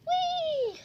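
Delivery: very high, drawn-out whee; high gliding cry of play; playful whee. Pretend. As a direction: squeal it as if tossing something up. Throws the dog up.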